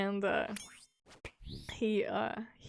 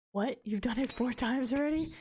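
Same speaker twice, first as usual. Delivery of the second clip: shocked question; 4 semitones higher than usual. Retold or imitated. Friend's shocked question, retold.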